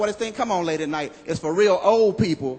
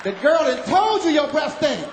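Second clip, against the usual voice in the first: shocked operator; 8 semitones higher, slower than usual. Retold or imitated. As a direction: cut in on the call, appalled. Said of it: "the operator broke it on the line".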